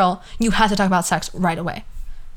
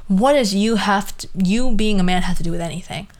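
usual speaking voice

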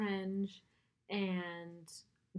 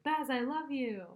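high, drawn-out mock gushing; exhilarated declaration; 7 semitones higher, 3 dB louder than usual. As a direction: gush it in a higher voice, slowly. Acts out Simon's exhilarated declaration.

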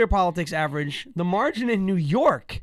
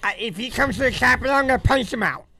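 mock Pelosi threat; 6 semitones higher than usual. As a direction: ham up a tough-talking old congresswoman. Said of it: "Nancy Pelosi thought she was gonna".

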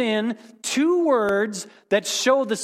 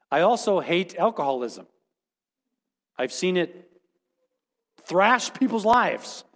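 usual speaking voice

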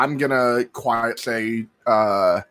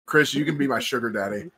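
ordinary talk

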